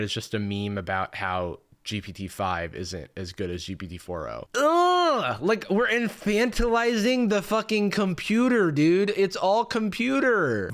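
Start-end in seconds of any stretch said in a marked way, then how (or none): 4.6-5.4 loud, high "ugh"; high-pitched cry of disgust; disgusted groan. Real, not acted. Disgust at the chatbot memes. 5.4-10.7 high-pitched exasperation; worked-up, high protest; exasperated rant. Real, not acted.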